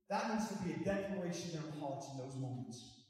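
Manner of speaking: ordinary talk, nothing marked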